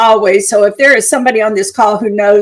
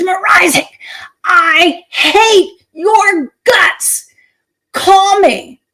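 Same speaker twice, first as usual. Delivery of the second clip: higher, strained mock scream with a crying edge; mock screaming voicemail; 7 semitones higher, much slower than usual. Client screaming at Mariza on her voicemail, higher mock scream. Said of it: "screaming like that".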